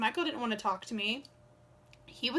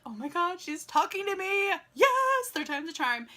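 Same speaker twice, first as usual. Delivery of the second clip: thrilled; 7 semitones higher than usual. Retold or imitated. Inner thrill at being noticed.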